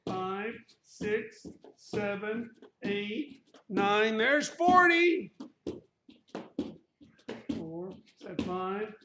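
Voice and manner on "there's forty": high, loud, slow callout; excited shout. Real, not acted. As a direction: announce the milestone with excitement. Calling out count milestone.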